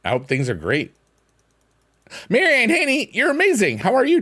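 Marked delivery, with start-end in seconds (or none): 2.3-4.2 squealed high and bright, louder; excited high greeting. Real, not acted. Streamer greeting a chatter, high excited squeal. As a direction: gush over the chatter way up high.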